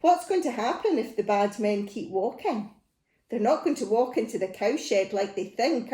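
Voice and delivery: plain reading voice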